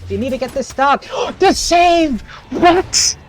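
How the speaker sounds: high, loud astonished shout; excited. Real, not acted. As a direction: gasp and yell at the save. Caster reacting to a huge save.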